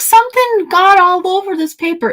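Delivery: high gasping alarm; shocked gasp. Retold or imitated. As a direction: gasp and panic over the paper. Retold gasp at machine.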